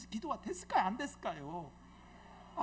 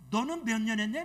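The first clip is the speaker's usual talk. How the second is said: put-on louder, heated demand from an old pastor; angry outburst; 2 semitones higher, 10 dB louder than usual. Old pastor snapping at younger pastors, loud and heated. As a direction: throw the question as a hot challenge. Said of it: "이분이 막 더 올라왔어".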